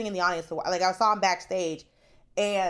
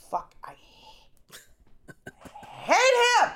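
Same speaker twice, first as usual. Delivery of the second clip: mock jealous outburst; 6 dB louder, faster than usual. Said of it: "and I was like".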